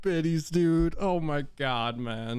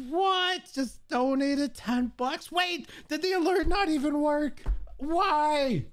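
high, incredulous exclamations; shocked outcry; 9 semitones higher, slower than usual. Real, not acted. Streamer shocked by a ten-buck donation, incredulous outcry. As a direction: pitch it way up in disbelief.

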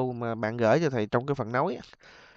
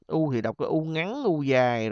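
ordinary talk

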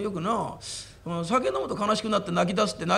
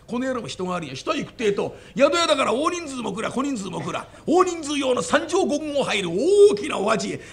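normal talking voice

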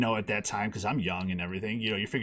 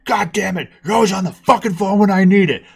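higher, louder angry yelling dad; 7 semitones higher, 8 dB louder than usual. Retold or imitated. Dad yelling at his son for hogging the phone, angry. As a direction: snap at the kid for hogging the phone.

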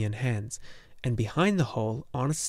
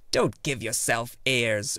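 put-on slow dismissive old woman; slower than usual. Urgle broke in.

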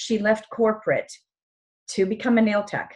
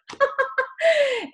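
very high, helpless laugh through tears; tearful laugh. Real, not acted. Presenter embarrassed by crying during her talk, tearful laughing. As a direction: laugh at yourself while crying.

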